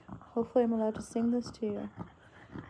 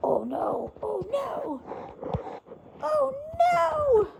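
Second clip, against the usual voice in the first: alarmed; 14 semitones higher, slower than usual. Real, not acted. Pets fighting, rising alarm.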